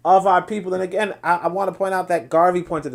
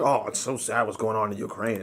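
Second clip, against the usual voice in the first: mock sympathy; 11 semitones lower, 6 dB quieter than usual. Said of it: "And they was like".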